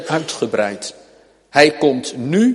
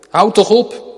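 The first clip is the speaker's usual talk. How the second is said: higher, sharper mock rebuke from the crowd; scolding crowd; 7 semitones higher, 4 dB louder than usual. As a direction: tell the blind man off, pitched up. Menigte snauwt Bartimaeus af. (hoog, berispend: de menigte legt Bartimaeus het zwijgen op)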